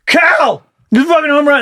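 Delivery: high-pitched thrilled outburst; excited fan yell. Acted. Fan telling alarmed family about Cal's homer, excited yelling. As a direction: blurt the big news, voice shooting up. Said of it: "And I just fucking scream".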